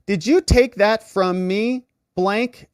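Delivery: slow suspicious question. Retold or imitated. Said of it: "Hunter says this".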